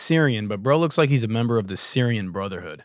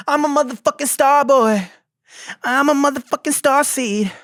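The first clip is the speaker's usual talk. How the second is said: much higher, loud half-sung shout; belted pop hook; 14 semitones higher, 5 dB louder than usual. Pretend. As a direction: belt it out like a pop star's chorus. Belting a pop hook jokingly.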